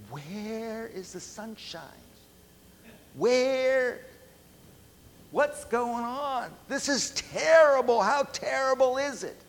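high, wailing mock complaint about the storm; whiny complaint. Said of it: "You would say".

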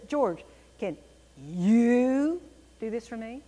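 long, loud "you" standing out from the sentence; stress on you. Shown as a demonstration. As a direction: punch and hold "you" mid-question. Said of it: "Or if I said".

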